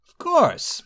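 high, proud assertion. A narrator's reading. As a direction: answer with lofty pride. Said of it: "said Iachesis proudly".

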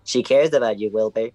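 calm him down kindly; reassuring. Acted. Reassuring the upset man.

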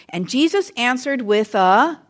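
slow rising "with a," left hanging; fill-in prompt. Shown as a demonstration. Preacher cues the kids to say question, slow unfinished rise. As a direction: stretch it and stop on a rise. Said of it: "when I stop and say".

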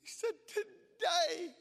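put-on old man overcome. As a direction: voice the sobbing volunteer, breaking. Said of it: "in his strong English accent and his blubbering mess".

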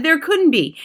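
high, loud disbelief; shouting. A narrator's reading. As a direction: protest loudly in disbelief. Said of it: "the others shouted".

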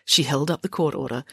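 ordinary delivery

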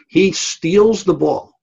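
drawn out, pitched high with stress on steals; slow build of thrill at the second steal; excited emphasis. Retold or imitated. Dramatic comeback moment retold.

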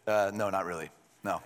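low, offhand mock reply; casually dismissive, dropped in pitch; deadpan refusal. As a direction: joke it as a dry brush-off. Joke answer voiced as Jesus.

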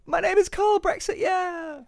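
very high-pitched silly intro. Acted. Silly echo of host's intro.

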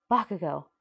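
a sharp, loud cry of the name; warning shout. A narrator's reading. Uraraka cries out as Bakugo's hand flames, loud. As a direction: shout to stop him.

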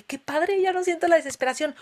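delighted. Retold or imitated. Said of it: "En este último retiro dije".

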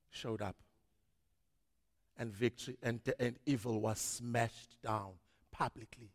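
ordinary talk

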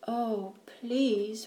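soft, small frightened plea; whimper. A narrator's reading. Hiding child begs not to be found, whimpering. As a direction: plead under your breath. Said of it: "whimpered Abby".

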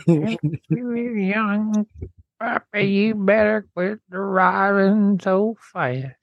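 put-on high, slow old codger voice; scolding old man. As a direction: wag a finger like a cranky grandpa.

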